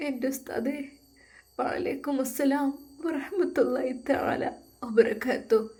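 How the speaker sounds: put-on a moved young woman returning the greeting through tears; tearful young bride. A narrator's reading. Blind bride answers, moved.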